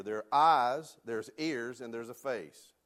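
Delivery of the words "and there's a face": ordinary talk, nothing marked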